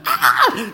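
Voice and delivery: high, loud burst of joyful laughter; excited laughing shout. Real, not acted. Telling viewers about his first Patreon, loud joyful laughing whoop. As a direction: let out a delighted whoop.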